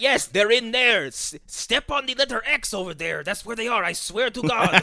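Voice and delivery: high, hammed-up fake-honest servant; obvious liar voice. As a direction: sell an obvious trap with a wink. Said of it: "I'm a bad guy, wink".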